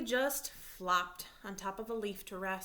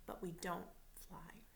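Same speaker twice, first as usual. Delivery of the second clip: soft unvoiced whisper; 3 semitones lower, 9 dB quieter than usual. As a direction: let it fall to a whisper. Mom gently telling son.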